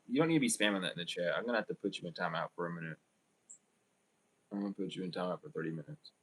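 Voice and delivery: low, soft, hurried warning; fast, quiet telling-off; quick moderating aside. Real, not acted. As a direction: drop the voice and rattle off a reprimand to the chat. Aside to a chat spammer.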